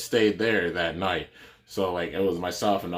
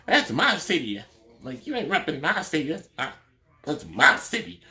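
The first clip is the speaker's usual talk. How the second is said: higher-pitched sassy attitude; Madea attitude; 8 semitones higher than usual. Retold or imitated. Stranded woman snapping at the receptionist, in a high sassy voice. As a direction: give it big-time Madea attitude. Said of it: "she was kinda a little bit like Madea".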